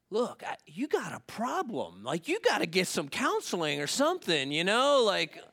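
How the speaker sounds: mock fed-up scolding; exasperated. Acted second-time exasperation with thief.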